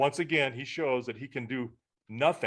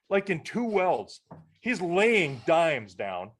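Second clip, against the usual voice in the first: drawn out, much slower and higher; slow emphasis; 5 semitones higher, much slower than usual. Real, not acted. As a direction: lean on each word slowly in awe. Marvelling at the son's welding.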